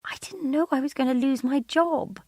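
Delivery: high, pleading self-defence; defensive protest. Acted. Wife defending herself to her husband, defensive. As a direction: plead your case, upset.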